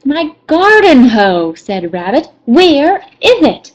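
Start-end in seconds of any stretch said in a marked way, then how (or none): 0.0-1.5 huffy. Acted. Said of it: "in a bit of a huff". 2.4-3.7 huffy demand. Acted. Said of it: "in a bit of a huff".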